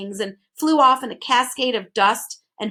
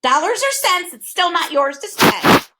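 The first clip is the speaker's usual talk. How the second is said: high, louder, lofty scolding; haughty bird; 8 semitones higher, 6 dB louder than usual. A narrator's reading. Bird scolding Milo over spending, haughty. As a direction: look down your beak at the boy. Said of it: "the bird replied haughtily".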